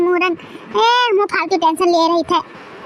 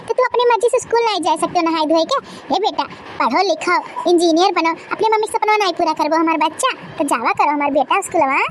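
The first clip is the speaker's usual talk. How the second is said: ordinary talk, nothing marked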